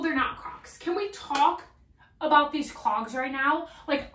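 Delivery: high-pitched, emphatic raving; excited gushing. Real, not acted. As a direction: rave about it, leaning hard on key words. Gushing over the new clogs.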